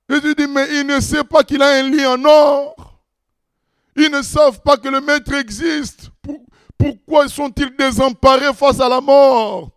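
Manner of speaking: impassioned preaching. Acted. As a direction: preach it hard, full of fire. Preaching at full force.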